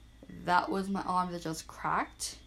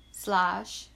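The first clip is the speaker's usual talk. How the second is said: ordinary delivery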